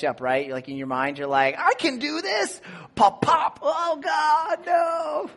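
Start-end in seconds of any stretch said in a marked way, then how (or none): 1.6-2.6 mock bravado. Overconfident inner voice before injury. 2.9-5.3 high yelp of pain, hammed up; retold pain yelp. Hurting his knee, a mock shout of panic.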